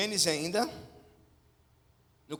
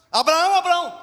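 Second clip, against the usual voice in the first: urgent divine call; 11 semitones higher, 14 dB louder than usual. Acted. God shouting to Abraham.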